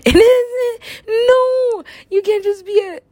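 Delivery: high-pitched, drawn-out objection; acted pleading complaint; whining protest. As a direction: wail the denial up high. Arguing back in acted protest.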